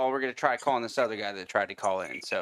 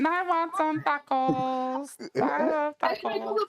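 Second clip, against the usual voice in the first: mock high voice; much slower than usual. Joking falsetto reply about tacos.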